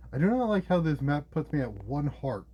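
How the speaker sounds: ordinary talk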